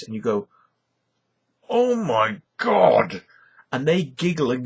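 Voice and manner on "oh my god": loud, high, drawn-out gasp of horror; mock horrified shock. Viewer reacts to a shocking video, mock horrified. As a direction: stretch each word out in exaggerated appalled shock.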